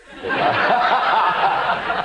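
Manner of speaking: high breathy burst of laughter; real laugh. Real, not acted. Recalling his wife taking over, real laughter. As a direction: let a laugh break out, airy and high.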